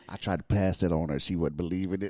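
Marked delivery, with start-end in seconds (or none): none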